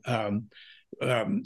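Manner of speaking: normal talking voice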